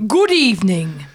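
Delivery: low, punchy "good evening"; newsreader greeting. Acted. Newsreader greeting viewers after the intro, mock formal. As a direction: open the bulletin with weight. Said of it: "time for the news with [name]".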